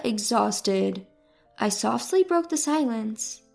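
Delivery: usual speaking voice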